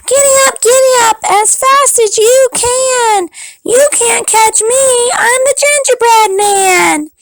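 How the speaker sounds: high, bold, teasing chant; high taunting cookie voice. Acted. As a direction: taunt them in a tiny high voice. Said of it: "and said".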